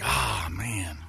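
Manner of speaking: hushed, whispered groan of dread; dismayed sigh. Retold or imitated. Dad seeing his ex-wife is calling, hushed dismay. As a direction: sigh it out very softly. Dreading ex-wife's phone call.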